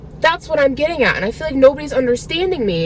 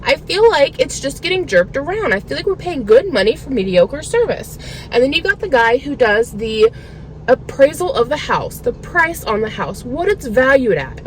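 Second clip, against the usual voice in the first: normal talking voice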